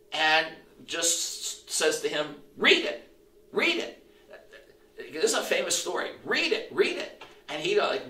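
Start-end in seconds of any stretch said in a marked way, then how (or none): 2.6-4.0 put-on high-pitched, rising child's call; boy calling out. Preacher voicing a boy to Augustine, high child-like call. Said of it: "some boy comes by". 6.2-7.2 put-on high, sing-out child's command, repeated; boy calling out. Preacher repeating the boy's words, high child-like call. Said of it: "some boy comes by".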